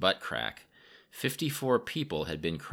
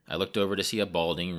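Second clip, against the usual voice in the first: plain reading voice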